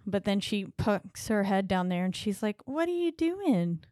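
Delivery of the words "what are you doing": high, slow sing-song mock taunt; playful taunting child. Retelling the girl poking her head under the bed, in a sing-song taunt. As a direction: coo it teasingly, imitating a little girl.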